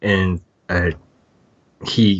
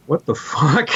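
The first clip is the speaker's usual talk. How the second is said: startled reaction; 9 semitones higher than usual. Retold or imitated. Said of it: "and Paul's like".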